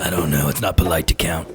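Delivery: low, mock-dignified drunk voice; deep goofy voice. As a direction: play a pompous tipsy gent. Joking about beer count.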